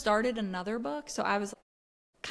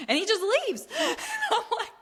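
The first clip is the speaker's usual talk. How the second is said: higher pitched, laughing as she tells it; laughing through it; 12 semitones higher, 3 dB louder than usual. Real, not acted. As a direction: giggle out the punchline. Laughing while retelling.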